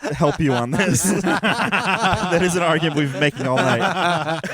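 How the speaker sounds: high, laughing through the words; amused, laughter-filled admission; laughing concession. Real, not acted. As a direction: give in while cracking up. Reluctantly backing friend amid group laughter.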